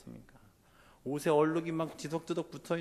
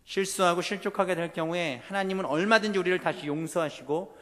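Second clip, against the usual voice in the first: raised, louder pulpit proclamation; impassioned preaching; 7 semitones higher, 10 dB louder than usual. Acted. Preacher tells congregation God knows their struggle, impassioned preaching. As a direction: press each phrase home to the congregation.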